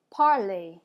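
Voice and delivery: "parler" as "par-lay", final R silent; French pronunciation. Shown as a demonstration. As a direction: model "parler" with its ending rhyming with play. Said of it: "repeat after me".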